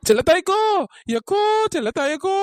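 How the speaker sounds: high, loud call for big sister; frantic shouting. Acted. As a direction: yell out for help from a distance. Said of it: "இந்த கத்து கத்திட்டு கிடக்குறது". (பதறிப்போய் சத்தமா கூப்பிடுறது)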